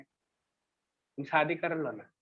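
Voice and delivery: usual speaking voice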